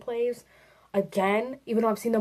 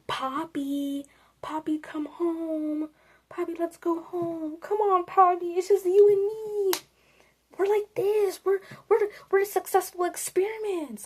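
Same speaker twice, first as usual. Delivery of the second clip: creepy luring experiment; 9 semitones higher than usual. Acted. Experiment coaxing terrified Poppy to come home, creepy.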